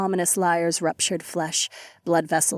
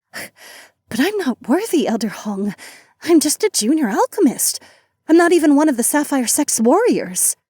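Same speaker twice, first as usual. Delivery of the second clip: higher, louder flustered disbelief; flustered protest; 7 semitones higher, 4 dB louder than usual. Acted. Disciple flustered by sudden honor.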